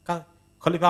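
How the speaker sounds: ordinary talk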